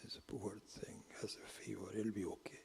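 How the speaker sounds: put-on mother murmuring to feverish child, whispered and soft; soothing mother's whisper. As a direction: hush the sick child tenderly.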